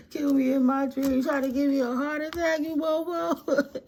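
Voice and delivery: indignant, half laughing; outraged. Real, not acted. Telling off the spirit that tried to kill her.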